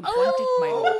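high, loud hammed-up English accent; overdone English accent, up high and loud; Tyrion impression. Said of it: "best Peter Dinklage as Tyrion".

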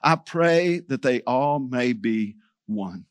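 low, soft, slow prayer; prayerful. Acted. Preacher voices Jesus' prayer for unity, prayerful. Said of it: "he prays this".

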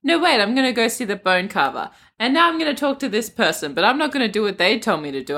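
usual speaking voice

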